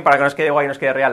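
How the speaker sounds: normal talking voice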